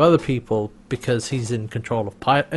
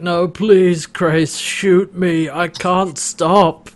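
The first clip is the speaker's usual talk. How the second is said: drawn-out, slightly higher begging; mock desperate pleading; 4 semitones higher, 4 dB louder, much slower than usual. Host plays bloated Rigel begging Crais, mock desperate. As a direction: plead helplessly in mild mock agony.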